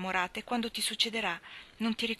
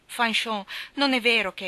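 louder, pressing call of her name with a falling end; insistent second call of Fanchon; urgent plea; 2 semitones higher, 7 dB louder than usual. Acted. Landry pleads before she leaves.

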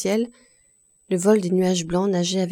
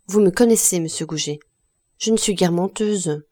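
ordinary delivery, nothing marked

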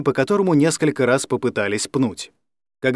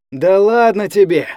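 slow, higher, louder whine; whining; 6 semitones higher, 5 dB louder than usual. A narrator's reading. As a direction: drag it out like a begging drunk. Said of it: "проныл Серёга". (ноющим тоном)

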